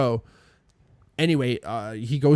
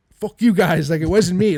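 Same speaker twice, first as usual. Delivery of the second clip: loud, higher indignant protest; Danny; 5 semitones higher, 7 dB louder than usual. Retold or imitated. Accused housemate telling his friends off, retold indignant outburst.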